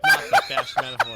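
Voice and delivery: high, loud laughing squeal. Real, not acted. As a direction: laugh through the words. Laughing retort.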